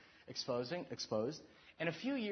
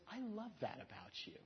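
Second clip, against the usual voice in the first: tender wife; 5 semitones higher, 11 dB quieter than usual. Retold or imitated. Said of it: "And she goes".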